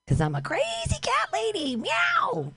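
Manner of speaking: high squeaky cat-lady voice, ending in a meow; crazy cat lady. Pretend. Comic hamming the crazy cat lady to street passersby.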